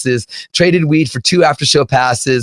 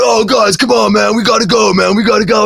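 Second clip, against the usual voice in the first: rallying the band; 7 semitones higher than usual. Retold or imitated. Dime urging the band to head out, rallying call. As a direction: round up the guys.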